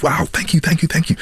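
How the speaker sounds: gushing thanks. Retold or imitated. Producer gushes and shakes his hand.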